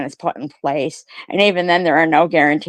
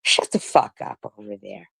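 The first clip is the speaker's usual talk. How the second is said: usual speaking voice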